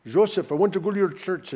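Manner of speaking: put-on half-hearted go at a Swedish accent; attempted Swedish accent. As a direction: imitate a Swedish friend's accent, not quite landing it. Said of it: "He has this cool accent".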